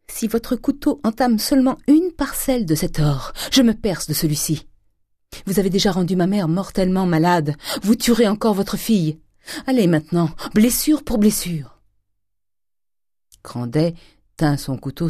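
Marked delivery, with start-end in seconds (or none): 0.1-11.8 high, impassioned threat; Eugénie, desperate defiance. A narrator's reading. Daughter threatening herself to stop her father, desperate defiance. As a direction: challenge him with rising intensity.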